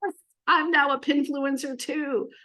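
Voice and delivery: mock-amazed, then triumphant; acted excitement. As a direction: play a thrilled new convert. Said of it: "So you're gonna leave like".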